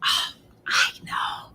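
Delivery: secret whisper; hushed secret told to the children. Acted.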